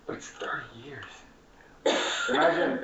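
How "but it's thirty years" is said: hushed whisper, much softer. Real, not acted. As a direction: drop to a whisper for weight. Emphatic quiet climax.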